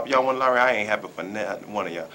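usual speaking voice

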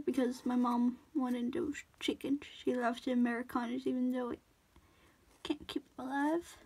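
hushed whisper. Real, not acted. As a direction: drop to a secret whisper. Whispered aside about mom.

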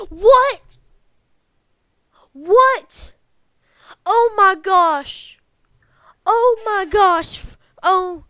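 shocked outburst. Real, not acted. Player reacting to the kiss reveal, loudly stunned. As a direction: blurt it out, stretching the gosh.

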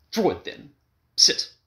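loud high command. A narrator's reading. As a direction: order her down. Husband commanding.

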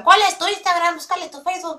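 high, eager mock fan voice; imitating fans asking. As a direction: play a pushy fan asking for her Instagram. Fans asking for her socials. (imitando a un fan insistente, voz aguda)

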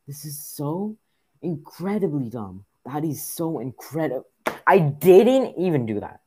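loud, high, exasperated ranting; raging at an unfair game; real gamer rage. Real, not acted. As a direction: protest loudly, pitch jumping up in outrage. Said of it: "I'm so mad".

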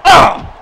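high, loud yelp; short play-acted scream; pained scream. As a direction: cry out as the attacker hits. Attacked by Zachary Dackery in play.

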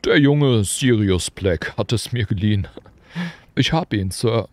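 a proud, simple giant reporting; Hagrid. A narrator's reading. Hagrid reporting to Dumbledore, hearty and proud.

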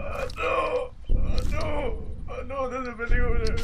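panicked. Real, not acted. Panicked high pitch.

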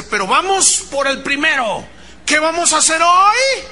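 high, loud rallying call; motivator. Retold or imitated. Coach rousing sales staff, motivator voice. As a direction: imitate a pumped-up sales coach. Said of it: "empecé a escuchar al motivador".

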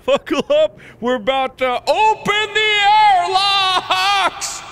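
big countdown-style cry, last word stretched; manic announcement. Acted. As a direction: holler it with wild glee. Gleeful announcement before opening airlocks.

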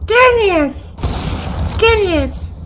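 very high, put-on squeal; high-pitched mock praise; falsetto exclamation. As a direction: cry it out in a silly falsetto. Falsetto comment on play.

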